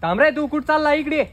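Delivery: loud, high call after him; shouting at the neighbour to stop; angry shout. Acted. Calling the neighbour out angrily.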